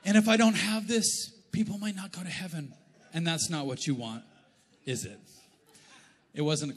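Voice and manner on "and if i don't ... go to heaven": mock earnest plea. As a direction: overplay the holy excuse. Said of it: "And I'm like".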